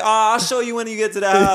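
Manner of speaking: high, loud mock reassurance; feigned helpfulness. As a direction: put mom off with an exaggerated excuse. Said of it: "I'm like".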